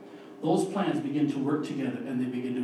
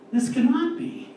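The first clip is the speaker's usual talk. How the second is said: higher pitched, alarmed refusal; fearful denial; 10 semitones higher, 4 dB louder than usual. Retold or imitated. Preacher voicing fear's anxious denial. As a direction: blurt it out as fear would. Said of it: "Fear asks".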